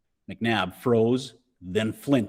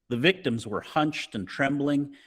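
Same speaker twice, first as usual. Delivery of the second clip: ordinary delivery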